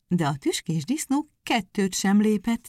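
plain reading voice

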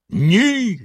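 a high, sharply rising yell on the second word. A narrator's reading. Bluebeard yelling at his pleading wife. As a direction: shout him down. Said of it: "загорлав синя борода".

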